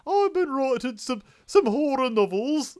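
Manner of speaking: bad Liverpool accent, voice up high; attempted Liverpool accent. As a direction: try a Liverpool accent and miss it. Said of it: "I can't do a Liverpool accent".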